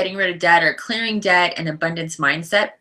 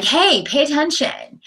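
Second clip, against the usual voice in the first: higher-pitched playful poke for attention; attention-grabbing call; 7 semitones higher, 3 dB louder than usual. Acted. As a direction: call out like a friend poking someone. Voices friends poking for attention.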